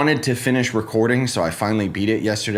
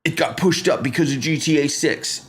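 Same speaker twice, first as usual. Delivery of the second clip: hyped fanboy; 7 semitones higher than usual. Retold or imitated. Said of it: "they're like".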